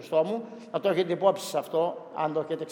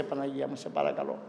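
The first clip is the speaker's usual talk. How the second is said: hushed prayer; 6 semitones lower, 11 dB quieter than usual. Real, not acted. Priest prays aloud for Ukraine.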